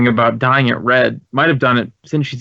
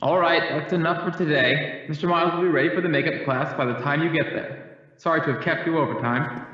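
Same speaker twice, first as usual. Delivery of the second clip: softer, heard from across the hall; wrapping up class from far off; distant teacher dismissing class; 9 dB quieter than usual. Acted.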